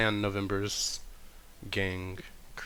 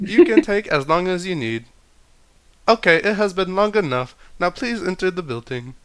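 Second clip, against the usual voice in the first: high, loud robot voice; 10 semitones higher, 8 dB louder than usual. Acted. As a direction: answer like a stiff robot. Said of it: "robot voice continues".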